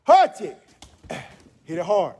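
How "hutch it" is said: sharp high count shouts; shouted count. Real, not acted. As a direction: bark out each count loud and high. Karate teacher shouting count.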